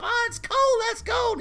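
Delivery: very high yelping cry; shocked yelp. Retold or imitated. Boy hit by cold hose water, high yelping cry. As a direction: gasp out at icy water.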